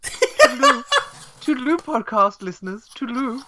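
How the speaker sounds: a silly high-pitched farewell; squeaky sign-off. Pretend. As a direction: chirp it like a cartoon. Trying out new catchphrase.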